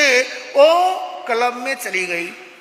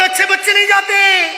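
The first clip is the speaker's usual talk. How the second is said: scolding shout; 8 semitones higher, 6 dB louder than usual. Acted. Mother refuses the child.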